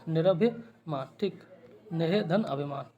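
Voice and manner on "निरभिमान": ordinary delivery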